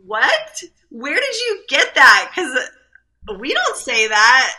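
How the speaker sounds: very high "What?" then firm scolding; mock outrage at the toddler; shocked mom. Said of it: "And I was like".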